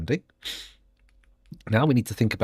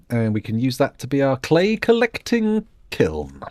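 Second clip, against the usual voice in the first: normal talking voice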